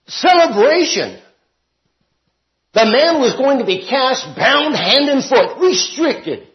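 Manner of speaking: high-pitched, emphatic pulpit delivery; forceful preaching. Real, not acted. Teacher giving the king's verdict on the guest, forceful preaching.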